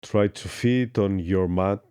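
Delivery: normal talking voice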